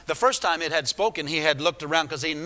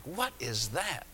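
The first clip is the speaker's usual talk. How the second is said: puzzled; 2 semitones lower, 9 dB quieter, slower than usual. Retold or imitated. Man puzzled by the voice.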